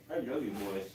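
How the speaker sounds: soft, hushed affection for the pigs; tender to pets. Real, not acted. Cooing to her guinea pigs after listing their toys, soft tender murmur.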